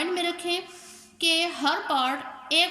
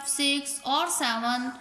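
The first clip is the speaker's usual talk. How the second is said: usual speaking voice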